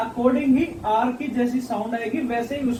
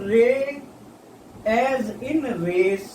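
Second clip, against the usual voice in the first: very slow, words drawn out; slow demo; much slower than usual. Shown as a demonstration. Teacher modelling the re sound for students, very slow. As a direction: stretch the example word for the class.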